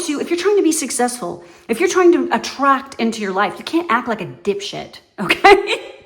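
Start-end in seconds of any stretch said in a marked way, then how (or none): 5.2-6.0 laughing. Real, not acted. Laughing after calling dipshit.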